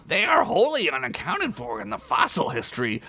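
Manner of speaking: mild matter-of-fact tone. Acted. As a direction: lecture the orc. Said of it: "Skunk said matter-of-factly".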